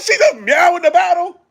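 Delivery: high, loud stunned disbelief; baffled shout at the meowing; incredulous outburst. Retold or imitated. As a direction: react like it's the strangest thing you've heard. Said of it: "I'm like".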